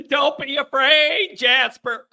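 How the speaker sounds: slow, high coaxing voice; mock reassurance. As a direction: coax him gently, stretched out and high. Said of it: "He says".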